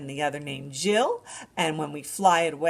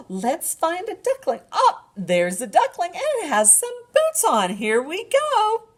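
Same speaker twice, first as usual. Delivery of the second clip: much higher, louder gasp of delight; excited discovery; 13 semitones higher, 6 dB louder than usual. Acted. Librarian delighting at a duckling for babies. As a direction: marvel at the duckling.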